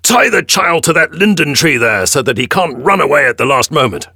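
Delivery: high, loud commanding yell; authoritative scream. A narrator's reading. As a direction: bark the order with full authority. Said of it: "he screams with even more authority".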